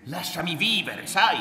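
loud, high, exasperated demand; heated protest rising in volume; angry outburst. A narrator's reading. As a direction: snap loudly to be left alone. Son angrily confronts his mother.

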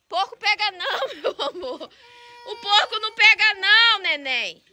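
high, soft reassurance to a little one. Real, not acted. Reassuring a small child about pig. (fala carinhosa e aguda com neném)

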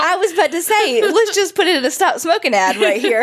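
high laughing joke. Real, not acted. Joking to her cohost about an anti-smoking ad, laughing. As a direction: say it while laughing.

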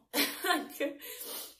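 breathy laugh through tears; teary laugh. Real, not acted. Thanking viewers, a teary laugh. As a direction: laugh off the emotion. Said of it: "I'm getting emotional".

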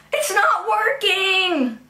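high, slow wailing cry. Acted. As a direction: cry it out in mock despair. Clock stuck, cries out in despair.

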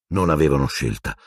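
plain reading voice

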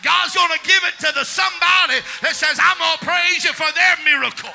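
loud, rising sermon shout over the organ; preaching at full force. Acted. A preacher rallying the church to claim their blessing, preaching at full force.

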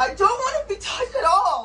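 high, loud, overwhelmed plea; distressed protest. Real, not acted. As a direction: push them off with your voice. Real argument, refusing to be touched.

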